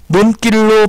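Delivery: 논길로 said as 논낄로, with tense ㄲ; slow 논길로 with a tense middle consonant; tensed pronunciation. Shown as a demonstration. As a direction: harden the ㄱ in 논길로 to ㄲ. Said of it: "발음을 하는데".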